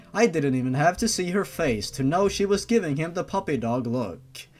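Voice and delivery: ordinary delivery, nothing marked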